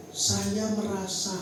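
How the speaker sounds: slow, measured statement of feeling; calm, non-blaming. Shown as a demonstration. Spouse softening a complaint to partner, slow and calm. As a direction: say "saya merasa" slowly, without blame. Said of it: "lebih calm".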